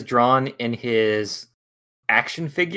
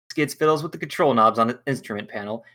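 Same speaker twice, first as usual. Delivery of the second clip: normal talking voice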